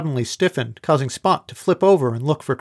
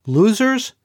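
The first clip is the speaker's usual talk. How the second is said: much higher, louder, a mocking laughing yelp; bursting into laughter; 8 semitones higher, 4 dB louder than usual. A narrator's reading. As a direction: blurt it as the giggles take over. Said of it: "Emily relapsed in uncontrollable laughter".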